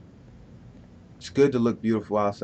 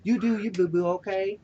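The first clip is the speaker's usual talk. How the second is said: high, lightly sassy pet-talk; sassy cooing voice; 8 semitones higher than usual. Retold or imitated. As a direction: coo it up to a higher pitch, playfully. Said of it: "I have to talk like this".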